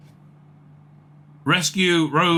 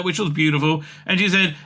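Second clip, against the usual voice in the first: ordinary talk, nothing marked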